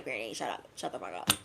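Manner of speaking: low, soft grumbling brush-off; low dismissive grumble at granny; mock grumpy reply. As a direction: mutter it down low, fed up.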